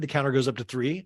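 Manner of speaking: ordinary delivery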